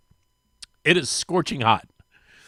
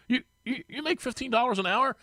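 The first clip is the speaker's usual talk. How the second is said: sheepish stammer; 9 semitones higher, 7 dB quieter than usual. Retold or imitated. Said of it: "he's kinda sheepish".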